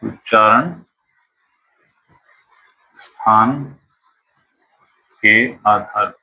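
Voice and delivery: उच्चारण, स्थान, के आधार पर said word by word with long gaps; slow spaced-out dictating pace; slow dictation. Shown as a demonstration. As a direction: dictate each word so students can write.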